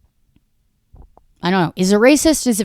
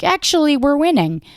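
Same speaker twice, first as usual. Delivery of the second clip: much higher smug "Actually, we're winning"; mock Trump; 9 semitones higher than usual. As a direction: correct them with smug pride. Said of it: "And he's like".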